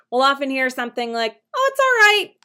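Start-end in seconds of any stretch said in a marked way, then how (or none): 1.5-2.3 breezy, high "oh, it's all right"; casual brush-off. Shown as a demonstration. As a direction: toss it off lightly, much higher.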